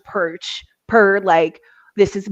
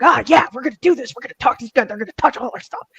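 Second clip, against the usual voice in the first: fast, eager mock hype; hyped up; 4 semitones higher, much faster than usual. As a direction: rattle it off like an overexcited ghost hunter. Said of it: "you're very much hyped up".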